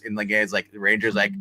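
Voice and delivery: ordinary talk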